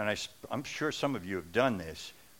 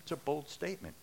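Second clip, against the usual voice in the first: ordinary talk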